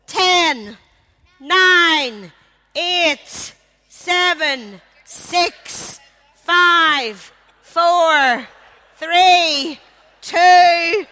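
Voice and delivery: shouting the final countdown; loud countdown. Real, not acted. Host counting down the timer.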